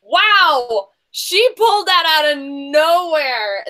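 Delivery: slow, emphatic astonishment; amazed. Real, not acted. Host hailing contestant's surprise answer, amazed. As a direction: marvel at it, stretching the words out.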